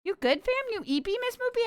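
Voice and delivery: normal talking voice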